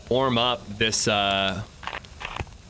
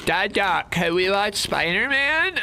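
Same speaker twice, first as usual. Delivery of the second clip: put-on high stuffy toddler voice; a congested little kid asking; stuffy-nosed toddler; 9 semitones higher than usual. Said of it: "nasally voice".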